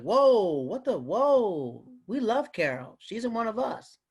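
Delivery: drawn-out protective whoa; offended crowd. Retold or imitated. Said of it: "everyone's like".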